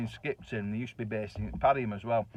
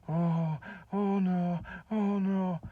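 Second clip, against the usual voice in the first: scared oh no; 7 semitones higher, much slower than usual. Retold or imitated. Husband shaking and scared.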